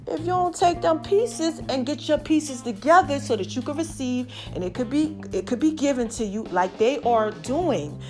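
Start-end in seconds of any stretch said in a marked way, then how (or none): none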